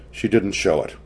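ordinary delivery, nothing marked

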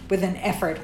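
plain reading voice

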